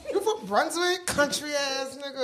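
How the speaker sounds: soft, giggly teasing jab; laughing tease. Retold or imitated. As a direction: tease him while laughing. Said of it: "I would be like".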